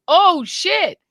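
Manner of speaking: loud, high yell of alarm; retold yell. Brother yelling about breaking news, retold yell. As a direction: imitate a brother's shocked shout.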